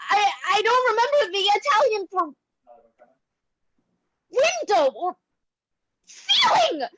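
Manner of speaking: breaking into tears; weep, grasping for lost words; tearful. Acted. Irina weeps, forgetting Italian.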